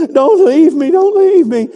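high, loud whiny plea; hammed-up pathetic begging. Shown as a demonstration. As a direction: wail it like a pitiful child. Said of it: "give me your best pathetic voice".

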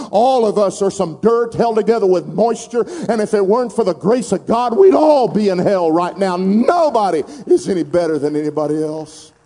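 loud, driving preacher's cry; preaching at full force. Real, not acted. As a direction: shout the truth over the crowd. Preaching climax to congregation.

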